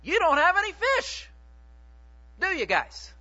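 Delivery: loud, high needling question; teasing jab. Retold or imitated. Jesus teasing the fishermen who caught nothing, loud and high. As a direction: poke fun at the tired fishermen. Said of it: "It's a little bit of a shot".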